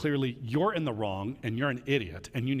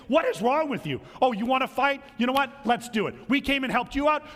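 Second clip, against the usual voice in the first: mock indignant; 8 semitones higher, 3 dB louder than usual. Mock indignant tribes ready to fight.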